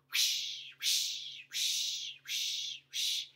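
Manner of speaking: whispered whoosh; brushing sound. Shown as a demonstration. Storyteller imitates painting strokes, breathy whisper. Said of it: "varnished and painted".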